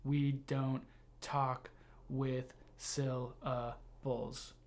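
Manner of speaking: slow "we don't talk with syllables", every syllable given equal time; robotic, equal timing. Shown as a demonstration. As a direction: say "we don't talk with syllables" as separate, evenly spaced beats. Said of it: "That would sound very robotic".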